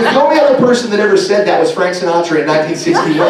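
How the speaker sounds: ordinary delivery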